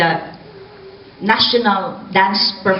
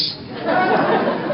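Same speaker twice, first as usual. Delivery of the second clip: airy laugh; 11 semitones higher than usual. Real, not acted. Laughs at physician quote.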